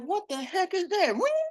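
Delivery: very high, drawn-out mock bewilderment; startled question. Dreamer reacts to a mysterious ring, high-pitched bewilderment.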